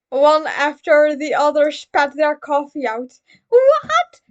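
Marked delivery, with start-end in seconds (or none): 3.5-4.2 much higher, louder frantic questions; panicked. Acted. Friends at the cafe panicking over the news, much higher and louder. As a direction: blurt it out in a panic. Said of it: "Everyone but Koichi panicked".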